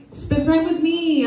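high, slow whiny plea for attention; whiny needy kid. Acted. A child whining at mom's new boyfriend, high and slow. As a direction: drag it out like a clingy child.

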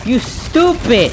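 high, slow, drawn-out insult; real gamer rage at an enemy; raging yell. Real, not acted. Raging at opponent.

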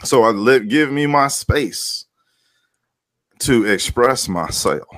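usual speaking voice

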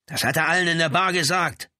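high, forceful answer; heated retort. A narrator's reading. Brother answers the lieutenant's question, heatedly. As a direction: throw the answer back hotly.

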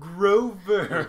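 calling out. Acted. Calling the cat by name.

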